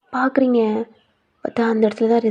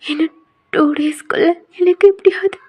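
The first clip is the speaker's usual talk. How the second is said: urgent pleading whisper, higher; desperate whisper; 7 semitones higher, 4 dB louder than usual. Acted. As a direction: beg in a hushed whisper so no one hears. Trapped heroine begs her friend secretly.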